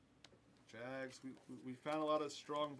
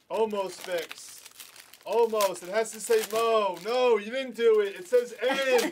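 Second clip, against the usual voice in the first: loud calling out; 5 semitones higher, 13 dB louder than usual. Real, not acted. Yelling at the fixer across room.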